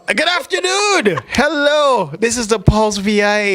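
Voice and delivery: high, drawn-out radio hello; high-pitched radio host opener; show-opening greeting. Real, not acted. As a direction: sing out a big welcome to listeners. Belatedly opens the radio show.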